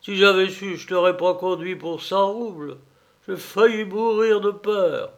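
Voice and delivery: relieved coachman. Acted. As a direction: let the fright spill out. Coachman admits his fright.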